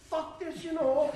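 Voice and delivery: high, loud burst of frustration; exasperated outburst. Retold or imitated. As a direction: blurt it out loud, fed up. Fed up, cursing his friend.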